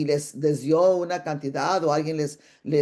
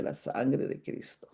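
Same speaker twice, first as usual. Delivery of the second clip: whispered gasp; 2 semitones lower, 8 dB quieter, slower than usual. Retold or imitated. Dismayed reaction to three years' debt.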